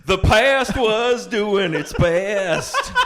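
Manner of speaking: high, very slow, laughing; hammed-up gentle voice; mock solemn. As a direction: drag it out like a tender TV host.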